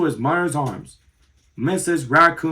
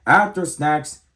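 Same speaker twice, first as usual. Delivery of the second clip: ordinary delivery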